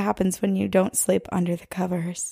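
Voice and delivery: hushed creepy whisper; spooky whisper. Pretend. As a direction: whisper it like a ghost story. Spooky bedtime monster joke.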